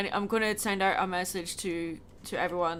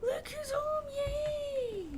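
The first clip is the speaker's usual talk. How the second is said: gasping, squealing high welcome; high excited cry of joy at the door; welcoming someone home; 4 dB quieter, much slower than usual. Real, not acted. Greeting pet as dad comes home.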